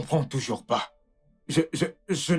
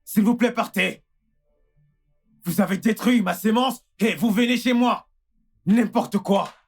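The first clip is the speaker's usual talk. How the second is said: higher, indignant outburst; outraged; 4 semitones higher than usual. Acted. Husband blaming doctor as he leaves, outraged. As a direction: hurl the blame at him.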